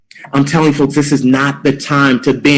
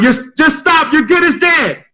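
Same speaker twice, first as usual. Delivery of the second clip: much higher mock shout; final yell; 11 semitones higher than usual. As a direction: give it one last big yell. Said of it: "Yell one more time".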